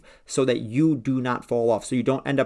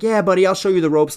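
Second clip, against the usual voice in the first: higher, louder know-it-all gym bro; cocky gym veteran; 7 semitones higher, 6 dB louder than usual. Retold or imitated. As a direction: play the smug old-timer showing a newbie around. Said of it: "he's like".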